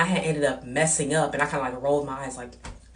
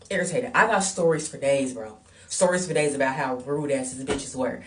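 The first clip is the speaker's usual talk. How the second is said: normal talking voice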